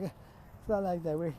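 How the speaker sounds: acted bashful stammer; flustered denial. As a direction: stumble over an embarrassed denial. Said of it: "he just blushes".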